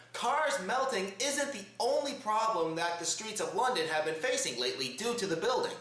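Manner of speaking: usual speaking voice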